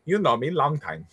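teasing a friend with the movie line; mock movie-quote accent. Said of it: "not I love you long time".

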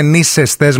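νήσεστες pronounced NEE-ses-tes, middle vowel open "e"; corrected pronunciation with epsilon. Shown as a demonstration. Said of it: "με έψιλον".

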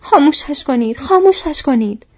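high, very loud begging shout; desperate pleading. A narrator's reading. Merry begging his friends from inside the tree, desperate. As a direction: beg at the top of your voice. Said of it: "مری التماس کرد".

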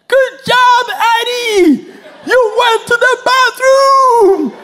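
high, loud cheering praise; wife cheering. Retold or imitated. Wife praising toddler for using the potty, loud cheering. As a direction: cheer like a proud mom. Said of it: "She's howling and shouting".

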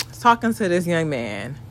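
usual speaking voice

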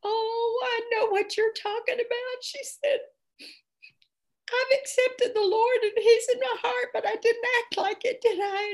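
high, tearful woman's voice; a hint of tears in a high pleading voice; tearful confession. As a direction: sound close to crying as you admit it. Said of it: "the lady started crying and she said".

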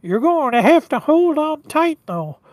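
high, whiny reindeer warning; whiny, nasally Rudolph. Acted. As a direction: warn them in a nasal cartoon voice. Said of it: "he's got that kinda really whiny, nasally voice".

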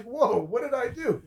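high, drawn-out mock surprise; feigned innocence. Acts out excuse to parents.

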